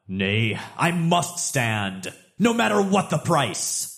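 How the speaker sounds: high, grand, slow heroic declamation; heroic Thor speech. Acted. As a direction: proclaim it like a doomed warrior god. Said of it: "And he says".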